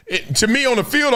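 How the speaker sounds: ordinary delivery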